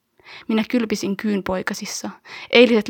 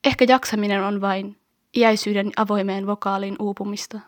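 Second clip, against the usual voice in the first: ordinary delivery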